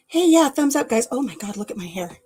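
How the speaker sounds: ordinary delivery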